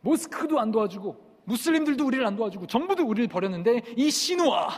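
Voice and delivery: high, loud outcry, building; impassioned shout rising to a peak; crowd shouting. Retold or imitated. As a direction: reenact villagers hollering in protest. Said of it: "뒤에서 사람들이 또 소리칩니다".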